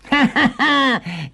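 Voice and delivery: high, gleeful cackle; short wicked laugh before a threat; evil witch cackle. Acted. Witch gloats over caged Hansel. (risatina malvagia da strega)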